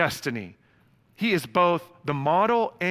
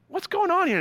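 bewildered dad; 14 semitones higher than usual. Retold or imitated. Bewildered dad questioning crying kids.

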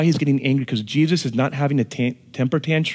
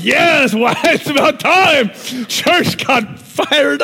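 excited churchgoer; 11 semitones higher, 5 dB louder, slower than usual. Retold or imitated. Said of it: "Some of us will be like".

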